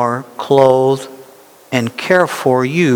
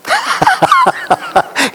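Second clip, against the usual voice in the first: high laugh. Real, not acted. Laugh after fear-based grace.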